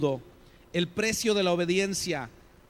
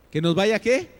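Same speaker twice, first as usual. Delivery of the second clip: "qué" pushed up and much louder; louder rhetorical question; 4 semitones higher, 8 dB louder than usual. Shown as a demonstration. Preacher pressing the congregation for an answer, louder and rising on "qué".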